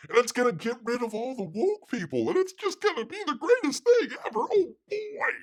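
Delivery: squealing high mock enthusiasm; mocking the script doctor. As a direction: gush like a giddy fanboy. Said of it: "he was talking about".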